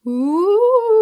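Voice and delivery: high, loud wobbling ooh; ghost wail. Shown as a demonstration. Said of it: "hear how your voice is kind of shaking".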